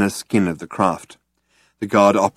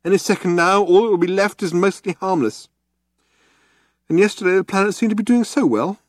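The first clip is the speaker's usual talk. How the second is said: Arthur's thoughts; 13 semitones higher than usual. A narrator's reading. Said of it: "Arthur thought to himself".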